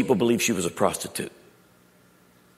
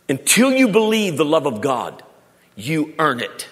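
preaching emphasis; 5 semitones higher, 6 dB louder, slower than usual. Real, not acted. Preaching climax.

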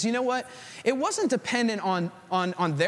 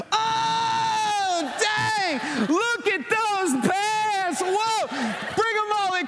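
over-the-top excitement; 8 semitones higher than usual. Retold or imitated. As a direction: gush like the biggest fan of her outfit. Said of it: "She wants me going".

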